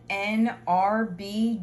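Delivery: ordinary talk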